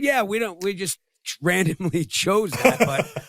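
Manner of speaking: mock cheerful rep, laughing through it; imitating AI company. [name] mimicking the AI company rep's breezy answer, high and lively. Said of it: "they said".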